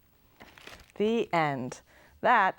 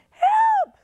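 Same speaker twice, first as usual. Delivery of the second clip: even higher piercing scream, very loud; scream for help; 9 dB louder than usual. Acted. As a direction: Lulu shrieks in panic. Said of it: "screamed Lulu".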